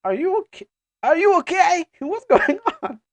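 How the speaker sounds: high, loud panicked questioning; alarmed companion. Acted. Companion checking on stricken Ayer, high and alarmed. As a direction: pitch way up in alarm.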